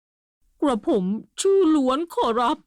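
a frightened servant's polite answer; trembling timid reply. A narrator's reading. Carpenter answering the angry general, timid and high. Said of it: "ตัวสั่นเหมือนลูกนก".

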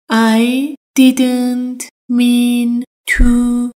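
very slow, drawn-out teaching model; slow drill. Shown as a demonstration. Teacher slowing the phrase for Persian learners, very slow. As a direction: stretch each word so learners follow. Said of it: "I didn't mean to یعنی".

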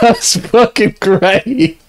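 high, slow words through laughter; laughing delight. Real, not acted. Streamer praising the replayed clip, helplessly amused. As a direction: gasp it out between laughs.

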